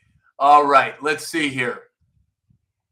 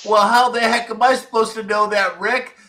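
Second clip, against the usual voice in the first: higher, louder and fast, playfully fed up; mock exasperation; 8 semitones higher, 7 dB louder than usual. Host teasing viewer Rick about his name, mock exasperated. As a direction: throw up your hands at Rick, all in fun.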